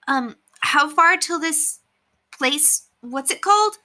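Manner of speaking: put-on a curious kid asking how far; young boy Curtis. Curtis asks Brendan how far it is, boyish voice.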